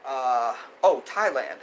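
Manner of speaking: usual speaking voice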